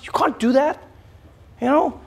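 ordinary talk

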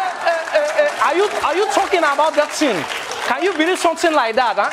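animated Nigerian disbelief at the traffic; playful Nigerian accent, incredulous. Retold or imitated. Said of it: "when Nigerians come inside".

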